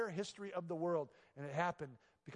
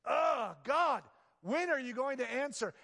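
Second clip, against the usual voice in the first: higher, louder cry to God, exasperated; anguished plea; 9 semitones higher, 7 dB louder, slower than usual. Retold or imitated. Believer waiting on God, crying out in exasperation. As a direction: groan it out under the weight. Acting a weary believer's prayer.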